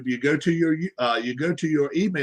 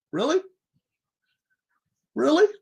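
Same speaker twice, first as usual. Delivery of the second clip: incredulous; 10 semitones higher, faster than usual. Real, not acted. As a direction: scoff in disbelief, pitched up high. Incredulous repeat.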